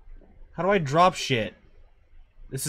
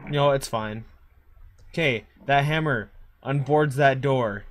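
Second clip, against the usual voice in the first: usual speaking voice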